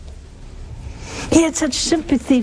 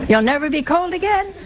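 higher, lilting rise at each end; mock reassurance; 5 semitones higher than usual. Preacher mockingly reassuring a sinner headed for hell, mock reassurance. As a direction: sell it as a perk, tongue in cheek.

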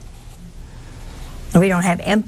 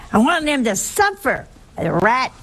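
imitating an angry wife, higher pitch at first; mock indignation, pitched up then dropping on the insult; retold mad wife; 3 semitones higher than usual. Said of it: "She was so mad".

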